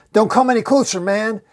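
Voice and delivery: high, loud threat; acted high yell; yelled warning. A narrator's reading.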